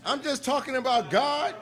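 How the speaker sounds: loud, driving sermon voice; preaching. Acted. Minister preaching to the mosque about Farrakhan, loud and rousing. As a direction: build the congregation up, hammering each line.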